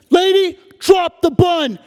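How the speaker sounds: armed officer screaming orders; police shout. Acted. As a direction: yell it like a cop in a raid. Cop yelling at mom.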